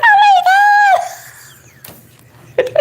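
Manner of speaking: squealing high, shocked "Oh my God"; shocked ladies. Retold or imitated. Imitating the ladies who saw the video, high shocked shout. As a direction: shriek it like a stunned viewer. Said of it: "they're like".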